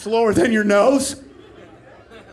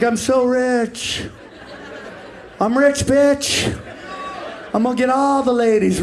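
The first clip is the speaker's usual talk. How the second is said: dreamy gloat; 3 semitones higher than usual. Retold or imitated. Dreaming sleeper voiced.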